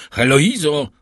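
loud, high call of a name; calling out again. A narrator's reading. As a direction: raise the voice and pitch to be heard. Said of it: "powtórzył".

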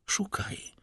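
hushed, whispered urging; whispered command. Acted. Count prodding Villefort to remember, whispered command. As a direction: whisper it low and insistent.

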